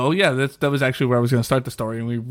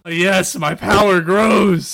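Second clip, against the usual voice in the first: gloating villain; 7 semitones higher, 6 dB louder, much slower than usual. Acted. Gloating over winning the round.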